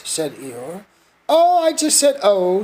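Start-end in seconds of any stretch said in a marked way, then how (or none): 1.3-2.6 nervous. A narrator's reading. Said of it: "said Piglet nervously".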